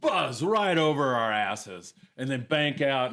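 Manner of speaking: normal talking voice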